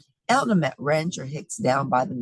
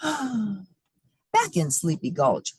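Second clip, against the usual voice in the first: dramatic gasp; 5 semitones higher, much slower than usual. Acted. Storyteller's dramatic refrain.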